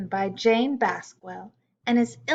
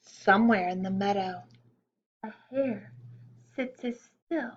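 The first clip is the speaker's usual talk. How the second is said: put-on reading a poem to children. A narrator's reading.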